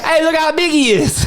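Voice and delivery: a hammed-up Louisiana voice; Louisiana accent. Friend joking about a bee in a Cajun accent. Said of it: "your Louisiana accent".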